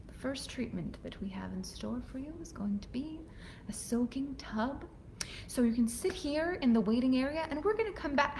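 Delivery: soft whispered spa-host voice; spa attendant whisper. Retold or imitated. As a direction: hush like a luxury spa attendant. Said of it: "he's talking to me like this".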